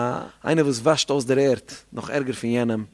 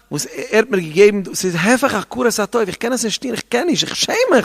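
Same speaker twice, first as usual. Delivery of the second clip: pleading Joseph; 4 semitones higher, 6 dB louder than usual. Acted. Preacher voices Joseph resisting temptation.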